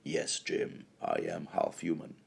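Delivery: quietly deadpan, like a Vulcan officer; Vulcan impression. Vulcan answering Jim about being half human, low deadpan.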